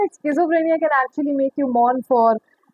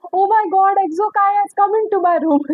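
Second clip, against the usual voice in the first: higher-pitched retold exclamation; mock astonishment; 6 semitones higher than usual. Telling viewers about seeing a lizard, mock astonishment. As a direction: act out a startled discovery.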